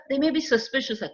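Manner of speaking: usual speaking voice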